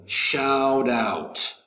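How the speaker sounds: "shout out" joined up as "shou-dout"; linked pronunciation. Shown as a demonstration. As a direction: link "shout out" with a D-like flap, drawn out. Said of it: "we'd actually pronounce it like".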